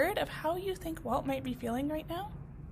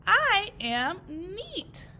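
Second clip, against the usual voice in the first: slow, loud, proud announcement; slow proud declaration; 5 semitones higher, 5 dB louder, much slower than usual. Acted. Walt telling the grasses, slow and proud. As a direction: savour the discovery.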